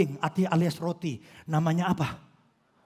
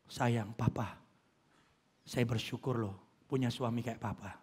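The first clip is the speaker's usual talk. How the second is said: soft, low, loving murmur; tender wife; 9 semitones lower, 9 dB quieter than usual. Retold or imitated. Wife greeting husband at home, soft loving murmur. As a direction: coo gratefully to your husband. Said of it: "nanti pulang bilang".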